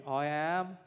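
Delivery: child's Brummie reply, imitated; Brummie accent. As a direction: put on a loud Brummie voice. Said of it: "I don't think they like the Brummie accent".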